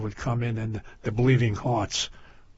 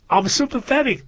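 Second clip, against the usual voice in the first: imitating a psychiatrist; 10 semitones higher, 6 dB louder than usual. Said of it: "the psychiatrist would think".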